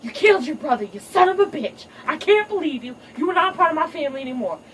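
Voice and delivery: high, loud mock rant, speeding up; hammed-up furious lioness tirade; furious mama. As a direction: scream it at the son you disown. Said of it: "she's like".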